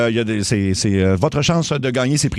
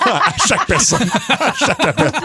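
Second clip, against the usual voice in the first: laughing; 11 semitones higher than usual. Real, not acted. Host laughing at caller voice.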